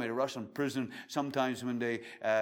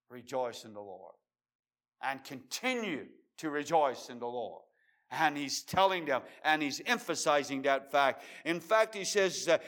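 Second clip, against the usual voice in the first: ordinary delivery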